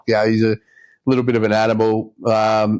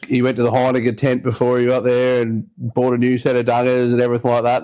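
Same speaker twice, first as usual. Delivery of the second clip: ordinary delivery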